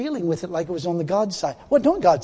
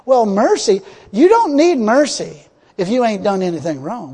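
normal talking voice

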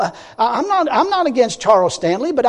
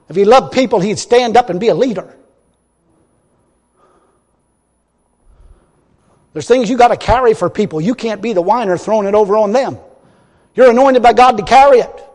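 fiery preaching; 4 dB louder than usual. Acted. Preacher mocks the minister to the congregation, loud and fiery. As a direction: thunder it from the pulpit.

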